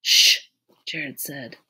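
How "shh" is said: sharp, forceful "shh"; loud shush. A narrator's reading. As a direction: shush the others loudly. Shushing the others.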